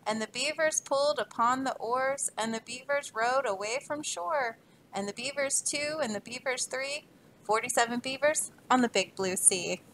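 put-on rhythmic read-aloud to children; story reading. Teacher reading a rhyming book to kids, lively. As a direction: read the rhyme to a class, bouncing along.

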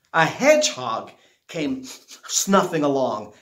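plain reading voice

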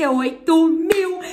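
excited announcement. Real, not acted. Vlogger tells viewers the channel's subscriber milestone, excited. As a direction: proclaim the milestone with a stretched-out final word.

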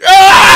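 high, loud peals of victory laughter ending in a scream. Real, not acted. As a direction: laugh and shriek in triumph. Screaming after the win.

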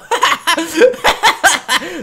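long helpless laugh; burst of laughter. Real, not acted. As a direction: crack up at your own failure. Laughs after repeated missed throws.

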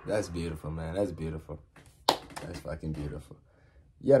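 normal talking voice

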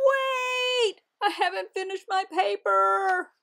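high, loud panic; panicked shout. Acted. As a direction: yell it in alarm. Panicked realisation.

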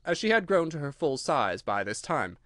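ordinary delivery, nothing marked

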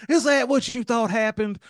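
high, strained movie-quote voice; mock indignant retort. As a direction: fire back as the arguing husband. Retelling the movie couple's argument.

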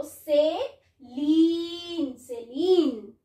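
सेलीन slow, with "leen" stretched out; American pronunciation, long ee. Shown as a demonstration. Teacher showing the American reading, सेलीन with long ee. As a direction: draw out the ee at the end of सेलीन. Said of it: "इसमें double ई का sound आएगा".

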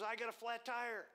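high, loud outburst; excited stranger. Retold or imitated. Stranger to driver about the road debris, high and excited. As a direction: blurt it out high and loud, imitating him. Stranger reporting his flat tire.

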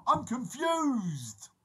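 high, drawn-out comic wail; mock confusion. Vlogger joking about a technical failure, hammed-up confusion. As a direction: wail it slowly in mock bewilderment. Acts out malfunction, then "only joking.

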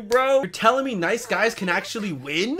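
ordinary delivery

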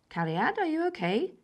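Mum calling. A narrator's reading. Mother checking on her child, high caring call.

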